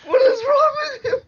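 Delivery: very high, tearful shouted outrage; hammed-up shriek. Bleeding climber to his shooters, hammed-up high shriek. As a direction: shriek it like a wounded squeaky victim. Shot character screaming at attackers.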